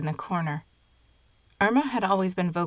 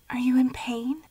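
higher, whispered, nervous question; 7 semitones higher than usual. A narrator's reading. Girl anxiously asking her sick grandmother, nervous whisper. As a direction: ask anxiously under your breath. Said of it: "I asked nervously".

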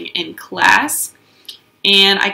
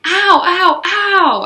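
much higher, louder ows; yelling; 11 semitones higher, 5 dB louder, much slower than usual. Acted. Junie yelling as Mother looks, mock yelp. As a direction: yelp each ow. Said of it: "I yelled again".

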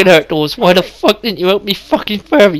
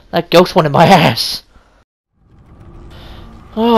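ordinary delivery

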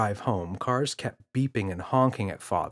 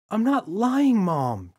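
put-on protesting child; 11 semitones higher, 4 dB louder, slower than usual. Boy protesting.

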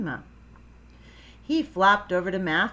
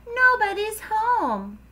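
put-on high-pitched kid complaint; crying out; 14 semitones higher, 4 dB louder than usual. A narrator's reading. Froggy complains to mom about his friends, crying out. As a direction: whine it out, stretching the end. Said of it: "cried Froggy".

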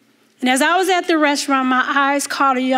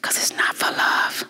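hushed whisper of a sad confession; 6 dB quieter than usual. Retold or imitated. Retelling the trafficked girl's confession in a whisper. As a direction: breathe the words out with no voice. Repeated refrain of her confession.

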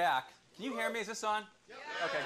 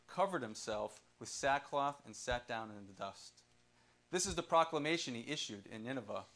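lower, softer solemn reading; scripture reading; 5 semitones lower, 3 dB quieter than usual. Acted. Preacher reading Jonah chapter three to congregation, solemn. Reads Bible chapter aloud.

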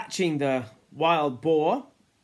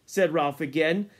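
ordinary delivery, nothing marked

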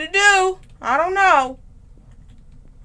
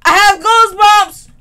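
goosebumps cried higher and louder; higher spooked exclaim; 6 semitones higher, 9 dB louder than usual. Real, not acted. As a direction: push the fear further up.

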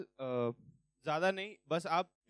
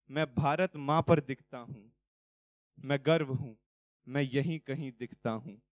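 patriotic poem recitation; 6 dB louder than usual. Acted.

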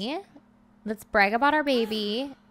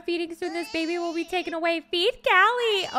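desperate command; 7 semitones higher than usual. Real, not acted. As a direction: yell it at the screen in panic. Desperate order to game sim.

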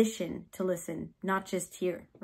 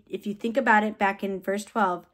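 ordinary talk, nothing marked

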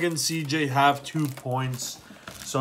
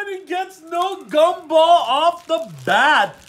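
excited; 13 semitones higher, 8 dB louder than usual. Real, not acted. Friend escapes the gumball.

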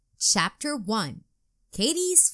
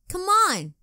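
eager call; 9 semitones higher, 6 dB louder than usual. A narrator's reading.

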